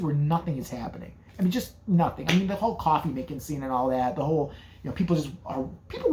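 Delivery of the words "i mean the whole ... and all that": ordinary talk, nothing marked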